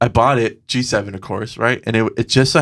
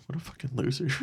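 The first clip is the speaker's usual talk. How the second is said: hushed; 15 dB quieter, faster than usual. Retold or imitated. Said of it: "be like".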